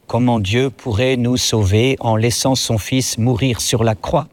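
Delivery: usual speaking voice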